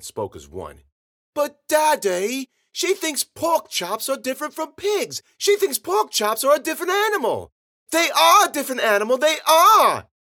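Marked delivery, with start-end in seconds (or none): 1.3-7.5 put-on very high, loud child's complaint; tattling little girls. A narrator's reading. Two sisters speaking as one to Daddy, tattling. 7.9-10.1 put-on very high, loud child's shout; bratty insistent girl. A narrator's reading. Girl defending her pork chop idea, shouting back.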